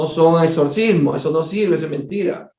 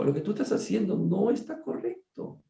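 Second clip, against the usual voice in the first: hushed spirit rebuke; 3 semitones higher, 9 dB quieter than usual. Retold or imitated. Spirit tells the doctor he is wrong, softly. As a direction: quietly relay the spirit's reproach.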